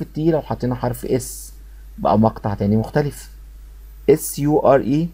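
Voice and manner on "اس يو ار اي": ordinary talk, nothing marked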